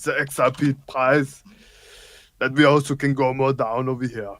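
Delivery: put-on thick Germanic-accented action hero voice; action-star impression. Co-host talking crypto on stream, action-star impression.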